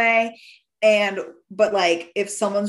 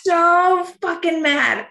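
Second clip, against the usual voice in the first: mock fury; 9 semitones higher, 4 dB louder, slower than usual. Retelling her anger.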